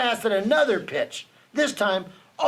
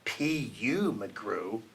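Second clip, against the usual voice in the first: much softer, hushed taunt; soft lowered mock whisper; 9 semitones lower, 9 dB quieter, much slower than usual. A narrator's reading. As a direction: kids whispering a taunt behind the backstop. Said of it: "My friends behind the backstop whispered".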